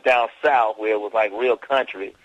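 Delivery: loud Southern country talk; Southern country accent. Shown as a demonstration. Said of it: "I had to talk like this".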